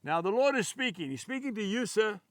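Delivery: usual speaking voice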